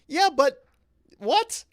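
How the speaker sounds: indignant disbelief, pushing back hard; outraged. Retold or imitated. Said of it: "And I'm, like, outraged".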